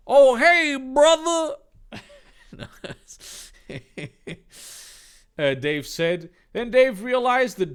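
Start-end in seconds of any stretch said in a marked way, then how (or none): 0.1-1.6 very high, loud, bro greeting; John's high greeting. Acted. John greeting Dave as a friend, high and loud.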